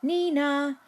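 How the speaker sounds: high, loud siren call. A narrator's reading. The cops arrive, a loud high siren. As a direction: sound like a police siren.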